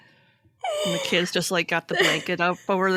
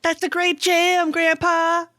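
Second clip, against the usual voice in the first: high, loud mock-enthusiastic child; cheering kid; 10 semitones higher, 5 dB louder, slower than usual. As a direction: hype up Grandpa's playing. Acts kid cheering grandpa's music.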